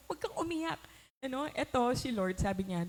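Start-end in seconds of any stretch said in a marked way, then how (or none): 0.1-0.8 high, stern order to stop crying; scolding parent. Pretend. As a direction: tell the child firmly not to cry. (mataas na utos sa batang umiiyak)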